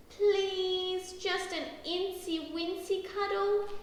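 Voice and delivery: put-on high wheedling child's plea; pleading. A narrator's reading. Daisy begs Mum for a cuddle, pleading. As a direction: beg for it in a high voice.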